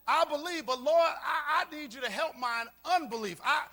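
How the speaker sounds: loud, pleading prayer; preaching at full force; impassioned cry. Acted. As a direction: cry out to the Lord with rising fervour.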